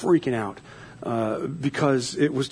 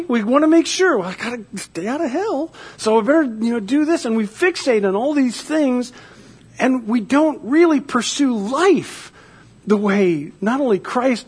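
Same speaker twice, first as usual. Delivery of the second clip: raised, fervent sermon voice; preaching; 8 semitones higher, 3 dB louder than usual. Acted. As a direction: preach it with rising conviction. Preaches on new life.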